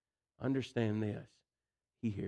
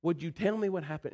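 exasperated parent; 7 semitones higher than usual. Retold or imitated. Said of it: "you're just looking at them going".

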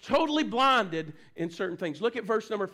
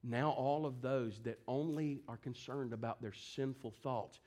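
low, soft, grave sermon delivery; subdued, prayerful preaching; hushed solemn preaching; 6 semitones lower, 7 dB quieter than usual. Real, not acted. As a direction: drop down low and quiet, pleading with the congregation.

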